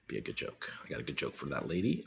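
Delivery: breathy unvoiced aside; whisper. Real, not acted. Streamer whispers an aside away from his wife, whispering. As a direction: whisper it off-mic. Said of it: "whispering aside".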